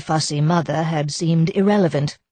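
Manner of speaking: plain reading voice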